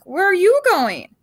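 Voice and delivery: slow, high, loud call. Acted. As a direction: call after someone who is leaving. Calling after Duck in storm.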